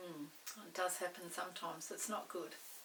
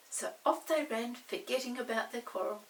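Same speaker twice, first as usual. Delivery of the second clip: usual speaking voice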